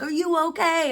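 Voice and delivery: high, caring "are you okay"; lightly imitating worry; concerned.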